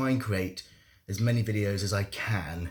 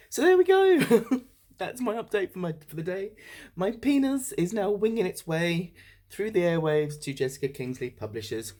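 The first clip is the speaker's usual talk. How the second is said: high, loud, laughing relief; real elated laughter in the voice; giddy and laughing; 9 semitones higher, 4 dB louder than usual. Real, not acted. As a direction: ride the giddy high after sending. Just sent book, giddy.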